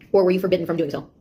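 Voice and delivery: ordinary talk, nothing marked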